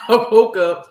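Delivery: laughing through the words. Real, not acted. Laughing at sleeping through movie.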